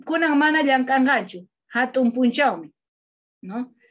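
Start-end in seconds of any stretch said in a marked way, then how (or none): 0.0-2.7 "llankanjachu" con jota suave y "hatun punchaumi" claro; pronunciación modelo. Shown as a demonstration. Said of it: "se pronuncia". ("llankanjachu" pronunciado con jota, como modelo)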